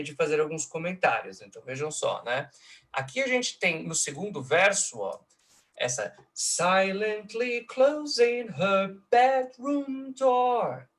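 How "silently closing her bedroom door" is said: high, slow, intoned "silently closing her bedroom door"; drawn-out verse scansion. Shown as a demonstration. Lecturer reciting the second verse, high slow scansion of "silently closing her bedroom door". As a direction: stretch each beat of "silently closing her bedroom door", almost chanting. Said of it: "uma alteração rítmica extremamente importante".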